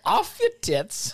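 very high, loud and drawn out; gleeful echoing shout. Acted. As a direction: whoop it back through laughter. Echoing slang, laughing.

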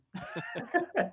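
bright high-pitched laugh. Real, not acted. Laughs at own cheap ammo.

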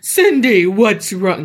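hammed-up demon calling her name. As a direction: do a put-on demonic voice. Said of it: "No, that sounds like a demon".

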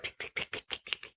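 quick voiceless tongue ticks; light, rapid tick-tick noises; imitated pattering feet. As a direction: make tiny running footsteps with your mouth. Imitates little doll feet running.

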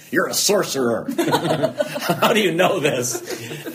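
high, loud mock-astonished outburst through laughter; laughing amazement. Patient retelling awe at the therapist's insight, laughing amazement.

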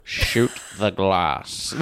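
lightly voiced villain, slow and low; booming echoing villain monologue. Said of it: "and he goes".

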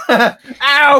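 very high loud yelp; stubbed toe ow. Shown as a demonstration. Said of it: "Let me hear you scream".

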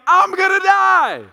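high, loud, stretched-out wail; hysterical child. Retold or imitated. Dad imitating his young daughter freaking out, screaming in panic. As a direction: wail like a little girl sure she's doomed. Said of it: "Riley's standing there going".

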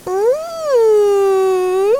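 high, drawn-out tempting hum; exaggerated mm. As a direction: ham up the yummy hum. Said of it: "with an exaggerated voice".

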